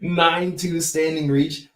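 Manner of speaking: high, slow, disbelieving; amazed. Real, not acted. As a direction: repeat it in awe, drawn out. Amazed repeating reach stat.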